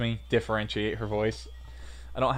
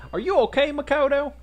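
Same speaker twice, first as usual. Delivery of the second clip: worried Southern belle, pitched way up; 7 dB louder than usual. Acted. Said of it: "There's my southern voice".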